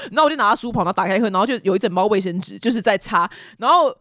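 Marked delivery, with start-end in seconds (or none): none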